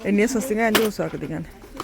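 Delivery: ordinary talk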